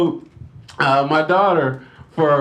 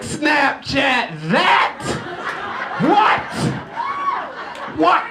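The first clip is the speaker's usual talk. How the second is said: mock gleeful taunt; 9 semitones higher, much slower than usual. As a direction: gloat at the kid.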